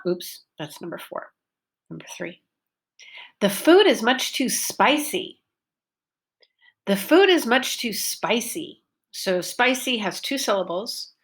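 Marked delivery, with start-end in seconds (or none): 3.4-5.4 SPIcy long vowel, pitch up then down; focus on spicy. Shown as a demonstration. Teacher modelling focus word spicy in class. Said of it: "I made the vowel long and clear". 6.9-8.8 SPIcy long vowel, pitch up then down; focus on spicy. Shown as a demonstration. Teacher modelling focus word spicy in class. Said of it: "I made the vowel long and clear".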